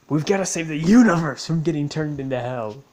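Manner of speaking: loud, swelling on universe; mock-heroic proclamation. Retelling the story's rising stakes, loud and mock-heroic. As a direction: declare it like an overblown hero's mission.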